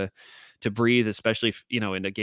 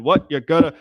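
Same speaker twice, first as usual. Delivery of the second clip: loud, higher hockey pundit bark; Don Cherry voice; 6 semitones higher, 9 dB louder than usual. Retold or imitated. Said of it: "like, Don Cherry on, uh, Canadian television".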